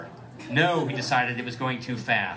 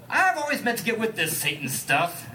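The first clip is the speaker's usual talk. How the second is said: a mock-gentle line, only a little higher; 9 semitones higher than usual. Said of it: "[name] said softly".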